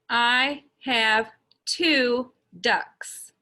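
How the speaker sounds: ordinary delivery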